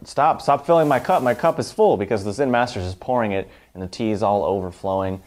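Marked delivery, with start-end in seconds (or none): 0.1-2.0 retold cry of alarm, pitched higher and a bit louder; shocked outburst. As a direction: blurt it out in shock as the cup overflows. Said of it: "he's shocked".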